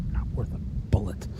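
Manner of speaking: scornful, breathy mutter; contemptuous whisper. Acted. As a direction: dismiss him under your breath. Boss dismisses him.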